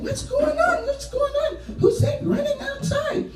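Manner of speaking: very high, startled cries; high frightened voices; alarmed family. Acted. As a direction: wake up scared in the night.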